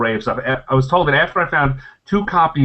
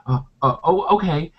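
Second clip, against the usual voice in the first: halting, reluctant squirrel agreement; hesitant squirrel; 7 semitones higher than usual. Acted. Squirrel agreeing to answer questions first, hesitant. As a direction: stammer a grudging okay as a squirrel. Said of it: "The squirrel says".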